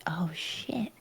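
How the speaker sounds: whispering. Real, not acted.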